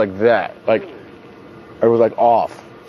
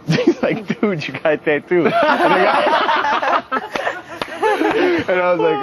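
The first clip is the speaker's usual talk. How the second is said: laughing through the words, pitch up high; can barely get it out for laughing; much slower than usual. Real, not acted. To friends, recalling the finished tattoo, laughing.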